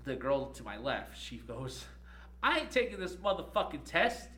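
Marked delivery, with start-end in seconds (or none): 2.4-4.3 loud, high defiant outburst; imitating a student's loud refusal; loud defiance. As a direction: retell her shouting she won't take the test. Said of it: "she says all loud".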